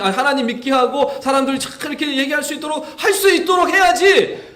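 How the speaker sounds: normal talking voice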